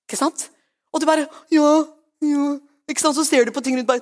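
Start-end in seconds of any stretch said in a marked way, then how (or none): 1.5-2.5 small, resigned mock assent; meek agreement. Believer giving in to the devil, mock meek. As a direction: give in feebly, imitating the gullible.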